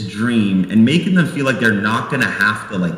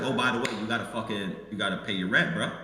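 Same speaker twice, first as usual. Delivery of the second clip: quieter, fast demanding scold; soft, quick mock reprimand; mock stern landlord; 3 semitones lower, 12 dB quieter, faster than usual. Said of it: "I'd be like".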